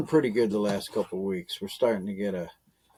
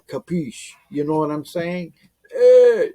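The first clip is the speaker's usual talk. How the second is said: mock gangster; 5 semitones higher, 8 dB louder than usual. Gangster catchphrase, then shout.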